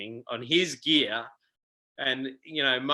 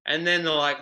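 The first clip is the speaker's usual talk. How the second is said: ordinary delivery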